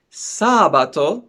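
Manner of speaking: sabato said slowly, SA-ba-to; stress on first syllable. Shown as a demonstration. Teacher showing learners Saturday in Italian, sabato stressed on SA. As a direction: lean on the opening "sa" of sabato and stretch it. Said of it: "we have the stress on the first syllable".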